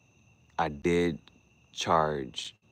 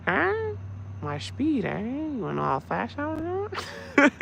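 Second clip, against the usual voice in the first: much higher, louder mock-innocent squeak; silly high voice; 4 dB louder than usual. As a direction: dodge the cop in a goofy squeal. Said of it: "change your voice up".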